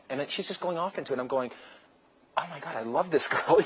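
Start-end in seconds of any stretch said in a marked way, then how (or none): 2.4-3.6 louder, higher, laughing excitement; smitten gushing. Retold or imitated. Magician telling how the dancer won him over, giddy gushing. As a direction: gush with rising delight, laughing through it. Acting out own smitten reaction.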